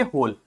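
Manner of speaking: होल begun with an h sound, no w; silent W in होल. Shown as a demonstration. Teacher showing how whole is read, W silent. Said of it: "डब्ल्यू का कोई pronunciation नहीं है".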